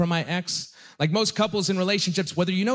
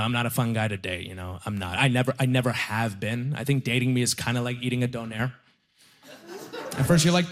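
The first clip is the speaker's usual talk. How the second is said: ordinary talk, nothing marked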